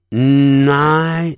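night with a long hummed N opening into the vowel; stretched initial N. Shown as a demonstration. Teacher showing how night begins, long nasal N. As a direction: draw out the N of night, slowly. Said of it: "本来是念night".

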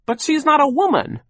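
very high, loud protest; high-pitched exasperated outburst; bursting out. A narrator's reading. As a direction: blurt it out urgently. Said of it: "Violet burst out".

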